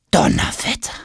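breathy astonished gasp of a word; astonished exclamation. Acted. Tom amazed at the royal gossip. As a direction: struck with amazement.

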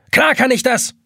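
hoch, laut, rachsüchtig; rachsüchtig gezischt. A narrator's reading. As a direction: zisch es voller Rache. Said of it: "zischt die Taschenuhr rachsüchtig". (Taschenuhr zischt rachsüchtig, hoch und laut)

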